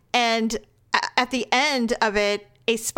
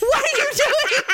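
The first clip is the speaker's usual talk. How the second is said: high, hammed-up scolding through laughter; mock outraged; 3 dB louder than usual. Woman retells Daryl catching her screaming, mock outraged yell. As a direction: imitate an outraged yell while cracking up. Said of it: "Like, he was outraged".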